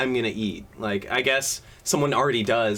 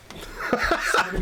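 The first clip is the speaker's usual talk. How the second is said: laughing; 8 semitones higher than usual. Real, not acted. Laughing after yelling at cat.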